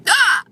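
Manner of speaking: high honking hiccup; dying goose hiccup. Real, not acted. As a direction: honk like a goose.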